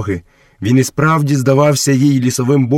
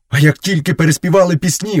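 higher, apologetic wolf voice; guilty; 7 semitones higher, 3 dB louder than usual. A narrator's reading. Wolf explaining himself to Mowgli, guiltily. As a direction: make excuses in a raised voice. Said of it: "винувато провадив сірий брат".

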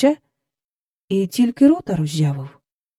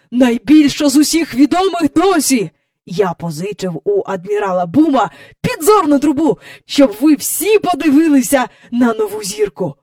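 high, loud, eager report; excited announcing; 7 semitones higher, 6 dB louder than usual. A narrator's reading. Mister Banks telling the family about a new star, excited loud announcing. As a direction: pour out the great news.

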